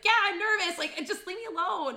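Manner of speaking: high, loud mock outburst; exasperated retort. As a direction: fire back, fed up with the question.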